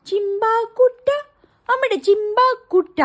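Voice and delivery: high, slow, loud doting coo. Real, not acted. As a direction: dote on the dog slowly. Cooing to the dog. (അമ്മയുടെ ചിമ്പാക്കുട്ട എന്ന് പതുക്കെ കൊഞ്ചിക്കുന്നു)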